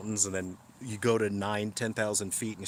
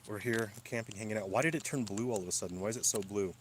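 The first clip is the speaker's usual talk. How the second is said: ordinary delivery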